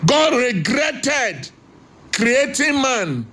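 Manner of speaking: slow, weighty, each word set apart; solemn slowed proclamation; slow emphasis. Acted. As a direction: drag it out like a verdict. Slow emphatic scripture line.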